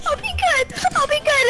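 mock crying. Begging while crying.

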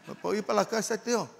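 a hint of a pleading kid; child's timid request. Child asking uncle to leave.

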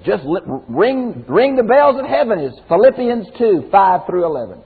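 ordinary delivery

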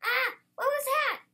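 high, loud, stretched-out alarm; startled. A narrator's reading. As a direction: cry out as if startled by a noise. Startled by a moo.

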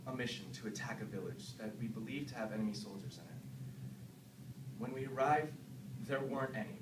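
low, soft, haunted recounting; hushed confession. Acted. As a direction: murmur it low and numb. Confessing killings of villagers.

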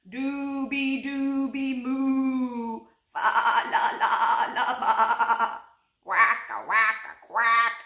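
loud slow sung moos and baas; animal chorus. A narrator's reading. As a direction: sing it like the barnyard choir. Animals rehearsing heard at night.

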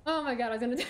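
ordinary talk

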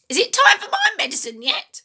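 put-on high, loud screechy old woman; screechy grandma voice. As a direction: nag him sharply from the next room. Grandma calls from next room.

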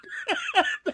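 short high cackle; quick squeaky burst of laughter. Real, not acted. Streamer amused by her flip side, high laugh.

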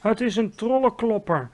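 anguished shout. A narrator's reading. Giant revealing the bad dream, anguished shout. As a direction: cry it out in fury and misery. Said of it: "schreeuwde hij".

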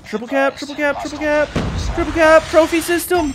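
high, rising hyped chant; excited. Real, not acted. Hyped repeated callout.

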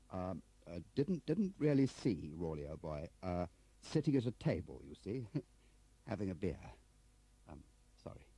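faltering excuse, swooping up then trailing away; hesitant bubbling bumbler. Acted. Voices flustered Crabbe answering.

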